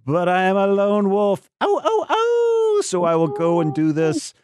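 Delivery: high falsetto wolf howl, slow; lone wolf howling. Acted.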